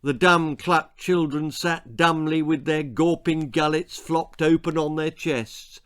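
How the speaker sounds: plain reading voice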